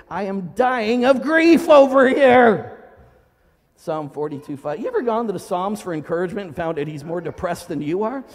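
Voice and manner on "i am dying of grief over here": loud, raised, drawn-out wail of grief; anguished outcry. Acted. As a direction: cry it out slowly at full voice. Acting out psalmist's despair.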